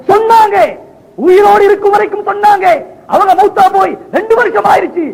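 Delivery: high, emphatic sermon cry; full-force preaching. Acted. Preacher voicing Abu Bakr's answer to the companions, full-force. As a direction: ring each phrase out high.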